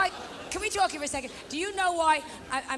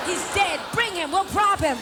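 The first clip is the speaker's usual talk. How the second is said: loud, high comic shout; louder shouted punchline to the crowd; 5 semitones higher, 7 dB louder than usual. Real, not acted. Joking about a dead date.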